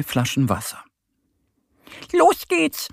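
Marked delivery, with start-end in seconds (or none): 2.1-2.9 high, squeaky little duck calling out; rufende kleine Ente. A narrator's reading. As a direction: call out eagerly as a little duck. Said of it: "ruft Annie". (hohe, fröhlich rufende Entenstimme)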